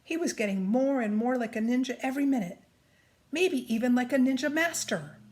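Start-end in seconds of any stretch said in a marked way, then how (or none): none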